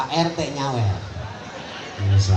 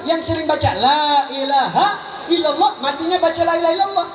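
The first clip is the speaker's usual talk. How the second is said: preaching at full force; 10 semitones higher than usual. Acted. Preacher drives home his refrain to the congregation, rising fervour. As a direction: build each line higher like a revival preacher.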